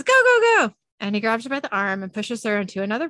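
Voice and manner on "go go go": loud high urgent commands; urgent shouting. Acted. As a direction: hurry them out, yelling. Fleeing flying vases.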